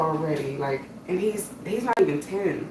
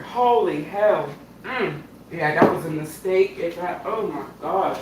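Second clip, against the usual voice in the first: normal talking voice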